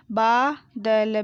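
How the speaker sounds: बादल drawn out as baa, da, la; very slow बादल, each letter held apart; spelled out. Shown as a demonstration. Teacher sounding out बादल for pupils, syllable by syllable. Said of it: "मैंने इस तरीके से पढ़ा".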